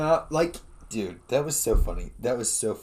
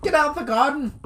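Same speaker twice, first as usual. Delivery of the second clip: higher mock granny scold; Irish granny; 12 semitones higher than usual. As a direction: bark it like a cross old nan.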